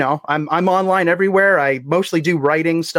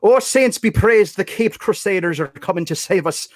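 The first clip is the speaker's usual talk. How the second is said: put-on Irish accent; 3 semitones higher than usual. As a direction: lean into a boozy Irish accent. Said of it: "slightly inebriated Chief O'Hara".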